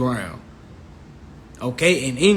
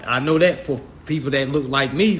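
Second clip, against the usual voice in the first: ordinary delivery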